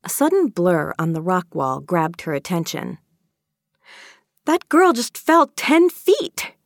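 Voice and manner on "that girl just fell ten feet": very high, loud, alarmed yelp; shrieky cry of alarm. Acted. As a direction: yelp it in shock, drawn out. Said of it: "she yelped".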